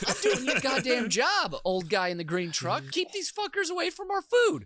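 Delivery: high, loud rant at a park ranger; indignant mock shouting. Camper wishing he could tell off the park ranger, mock shouting. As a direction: yell back in exaggerated outrage.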